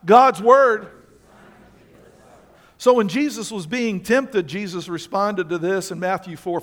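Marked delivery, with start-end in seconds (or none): 0.0-0.9 loud unison reading of "God's word"; read aloud. Shown as a demonstration. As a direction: call it out with the congregation. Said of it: "read it out loud".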